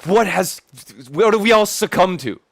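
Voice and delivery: mock despair. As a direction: wail at the dystopia. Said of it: "I'm just like".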